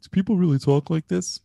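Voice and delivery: put-on high, whining kid question; whiny schoolkid. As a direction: play a bored pupil complaining. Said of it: "they're like".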